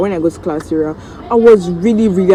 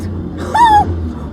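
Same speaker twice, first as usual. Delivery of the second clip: joyful squeal. Retold or imitated. Joy at mom's arrival.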